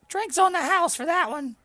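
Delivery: high, loud jolly innkeeper; high innkeeper shouting cheer; cheerful barkeep. Acted. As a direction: boom it out like a jolly barman. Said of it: "and Rove goes".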